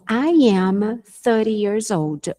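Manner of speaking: slow, careful teacher demo of the full sentence; unhurried classroom pronunciation model. Shown as a demonstration. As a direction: spell it out slowly for students. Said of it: "Então".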